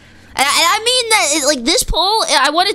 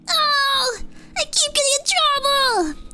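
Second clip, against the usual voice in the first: whiny high voice; 11 semitones higher, 3 dB quieter than usual. Pretend. Acts upset at being scolded.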